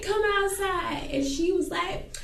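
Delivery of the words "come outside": high, drawn-out call; retold challenge. Calling friend out to the yard, high drawn-out challenge. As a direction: call it out slowly, pitched up. Retells the challenge after laughing.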